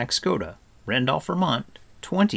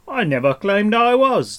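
high, loud indignant shout; shouting back; 11 semitones higher, 9 dB louder than usual. A narrator's reading. Stranger denying to Taran that he is Gwydion, shouting back. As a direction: yell back in protest. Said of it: "the stranger shouted back".

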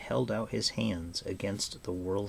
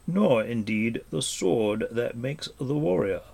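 ordinary delivery

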